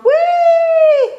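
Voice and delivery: very high, loud, drawn-out squeal; gleeful yell. A narrator's reading. Froggy riding without giving up, high loud yell. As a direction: let out a long high whee. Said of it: "He yelled".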